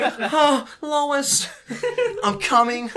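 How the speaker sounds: mock response to mom. Friend joking about answering a mom on the phone, silly voice. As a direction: ham it up, laughing through the name. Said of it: "You just be like".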